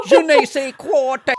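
high, slow, giggling French phrase; hammed-up French through giggles; laughing mock French. Real, not acted.

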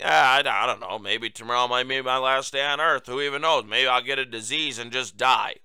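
low, listless doddering mumble; mock rambling president. Host quoting the president to listeners, mocking low ramble. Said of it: "the president of the United States saying".

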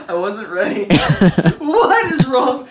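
high breathless laughing. Real, not acted. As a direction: crack up after spitting. Just spat drink laughing.

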